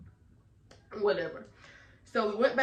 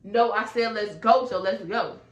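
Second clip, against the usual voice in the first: louder mock shout of let's go; retold insistent demand; 7 dB louder than usual. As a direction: insist hard that we leave now. Lola insists on leaving.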